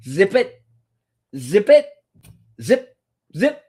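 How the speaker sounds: mock stern zip it; playful shush. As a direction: hush them in a mock bossy tone.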